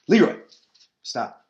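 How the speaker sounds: sharp loud call to the dog. Real, not acted. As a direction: call out the dog's name to stop him. Calling the dog mid-talk.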